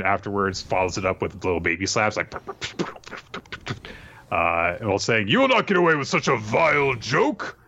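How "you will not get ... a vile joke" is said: high, put-on indignant voice; theatrical high-pitched outrage; mock outrage. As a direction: scold in hammed-up indignation. Acted outrage while slapping her.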